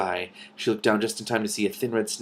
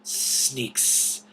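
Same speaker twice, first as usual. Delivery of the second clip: unvoiced hissing snake voice; soft hiss; 4 semitones higher than usual. A narrator's reading. Hidden snake warning the dragons, hissed whisper. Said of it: "hissed a soft".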